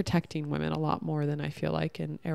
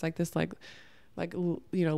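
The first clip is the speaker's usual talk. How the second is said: normal talking voice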